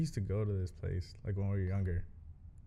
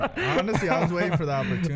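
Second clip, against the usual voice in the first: laughing; 7 semitones higher, 7 dB louder than usual. Real, not acted. Laughing while confessing.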